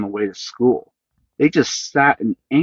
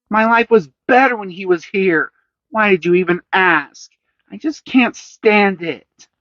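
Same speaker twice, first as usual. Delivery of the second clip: put-on sulky boy; 9 semitones higher, 3 dB louder than usual. A narrator's reading. Son complaining about missing father.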